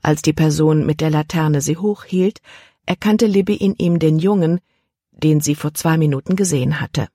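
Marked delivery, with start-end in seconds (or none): none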